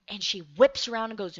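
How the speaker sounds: ordinary talk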